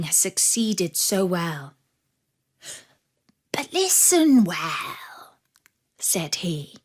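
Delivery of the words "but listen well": the wolf's scheming whisper, slow at first. Acted. The wolf whispering his plan to the sad dog, conspiratorial. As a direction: lay out the secret plan in a hushed whisper.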